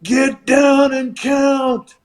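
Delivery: high, drawn-out mock holler; imitated yell. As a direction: order the referee like a hollering wrestler. Wrestler yelling at referee, retold.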